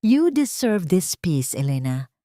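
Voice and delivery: slow, gentle reassurance to Elena; lightly voiced Gabriel. A narrator's reading. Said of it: "Ang sagot ni Gabriel". (mahinahong tinig ni Gabriel, marahan at mabagal)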